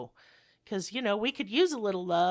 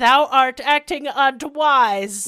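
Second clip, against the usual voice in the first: slow, loud olde-timey declaiming; mock olde English; 5 semitones higher, 12 dB louder, much slower than usual. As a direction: ham up archaic English, very slowly. Mock archaic 1600s court quote.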